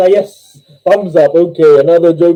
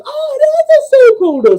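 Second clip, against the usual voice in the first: amazed joke nun; 3 dB louder than usual. Acted. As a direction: squeal the discovery in a high nun voice.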